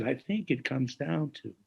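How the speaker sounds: put-on quiet, slow, slight New Jersey accent; pensive, hushed hint of New Jersey. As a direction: quote him softly in a light New Jersey accent. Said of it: "he had this New Jersey accent".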